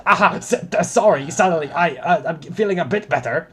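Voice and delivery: high, loud flustered recovery; startled back to full voice; speaking up. Acted. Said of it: "could you speak up?".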